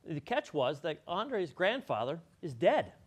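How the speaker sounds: ordinary talk, nothing marked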